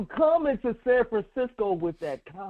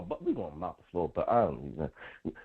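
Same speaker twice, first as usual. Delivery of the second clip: much lower and softer, quick; muttered aside; 8 dB quieter than usual. Real, not acted. Host slipping in a boast about next week, muttered. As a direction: mutter it under your breath, quickly.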